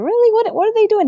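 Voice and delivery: put-on high, wide-eyed little girl voice; little kid asking. A four-year-old asking her dad about wrestling, in a high child voice. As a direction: play yourself at four, curious.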